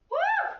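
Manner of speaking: a very high, loud whoop; excited woo. Real, not acted. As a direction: cheer them on with a whoop. Instructor cheering the class.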